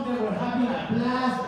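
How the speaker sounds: ordinary talk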